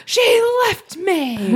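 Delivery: high, slow, put-on voice of a heartbroken man; mock tragic line. Guest telling the wedding crowd his bad news, mock tragic. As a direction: drag it out, high and pitiful. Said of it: "And he goes".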